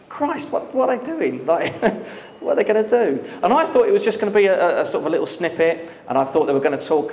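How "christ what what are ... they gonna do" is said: high, breathy half-whisper of disbelief; baffled disbelief. Retold or imitated. Thinking while Rage Against the Machine plays on a news station, baffled. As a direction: gasp out the confusion, pitched up. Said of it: "So I was thinking".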